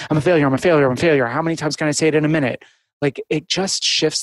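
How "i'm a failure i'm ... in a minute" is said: really fast. Shown as a demonstration. Therapist shows saying the thought really fast. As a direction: race through it like a tongue twister. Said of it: "say your thoughts really fast".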